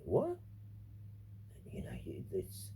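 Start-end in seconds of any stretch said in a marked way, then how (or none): 0.0-0.4 very high, rising disbelieving what; stunned mock reaction; shocked what. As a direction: play their jaw-dropping surprise. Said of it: "and they go".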